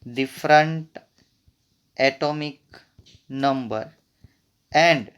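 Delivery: slow, word by word; dictation pace. Real, not acted. Teacher dictating a definition to students, slow dictation. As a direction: separate each word with a clear pause.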